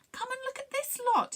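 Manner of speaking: very high-pitched excited call; shouted. A narrator's reading. Crowfin summons others to opened cases, shouting. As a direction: call them over in a high voice. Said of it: "he shouted".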